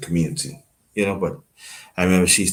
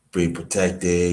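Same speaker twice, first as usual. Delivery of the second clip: usual speaking voice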